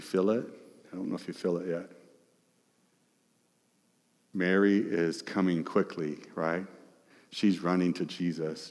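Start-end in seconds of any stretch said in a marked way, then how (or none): none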